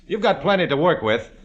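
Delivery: briefing the squad. Acted. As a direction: take charge and hold the room.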